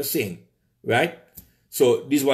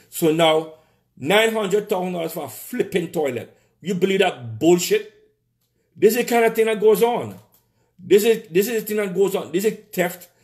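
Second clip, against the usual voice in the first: higher, louder, incensed about the theft; outraged rant; 7 semitones higher, 3 dB louder than usual. Real, not acted. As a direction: push the pitch up in disbelief. Outraged rant about toilet cost.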